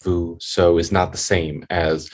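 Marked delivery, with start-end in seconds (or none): 0.0-0.8 slow stimulus syllables. Shown as a demonstration. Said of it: "Now notice that".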